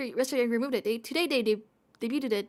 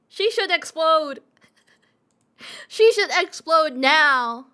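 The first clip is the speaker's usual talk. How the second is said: mock demand; 3 semitones higher, 9 dB louder than usual. Mock horror.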